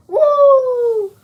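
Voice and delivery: high, loud wrestler woo; Ric Flair woo. Retold or imitated.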